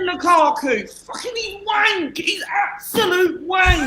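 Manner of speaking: high, drawn-out exasperated shout; outraged outburst. Real, not acted. Mate disputes that Lukaku should start, outraged shouting.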